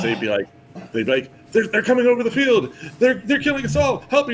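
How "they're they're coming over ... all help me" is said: high, urgent cry for help; hammed-up battlefield alarm; panicked voice actors. Imitating other actors reporting an attack, high mock panic. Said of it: "you'd get other voice actors".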